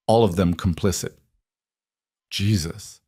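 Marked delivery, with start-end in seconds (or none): 2.3-3.0 breathed out softly in shock; a stunned breath of a word. A narrator's reading. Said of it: "Luca breathed".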